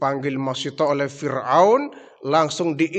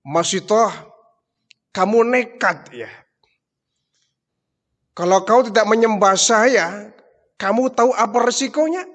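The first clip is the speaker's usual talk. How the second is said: growing anger; 5 semitones higher, 4 dB louder than usual. Acted. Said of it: "Fir'aun semakin jengkel, ya, semakin marah".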